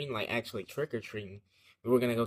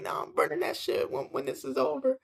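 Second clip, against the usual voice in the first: scolding mom. Retold or imitated. Retold mom's threat, put-on voice.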